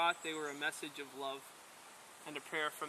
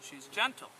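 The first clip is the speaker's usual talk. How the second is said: robin chirp. A narrator's reading. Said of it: "chirped the robin".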